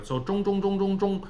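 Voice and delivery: 中 said zhōng, level and nasal; nasal zhong drill. Shown as a demonstration. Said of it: "nasal sound".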